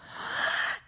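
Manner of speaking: mock gasp. Real, not acted. Mock shock at question.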